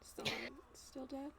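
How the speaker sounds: breathless unvoiced whisper; hushed dread at the silent line. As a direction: whisper it, scared of the answer. Whispered worry over dead phone.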